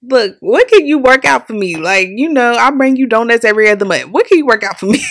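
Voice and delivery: loud, high, wheedling plea for a deal; pleading with dealers. Retold or imitated. Calling dealer contacts for a car deal, loud pleading. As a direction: beg loudly and push for a favor. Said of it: "I'm calling people".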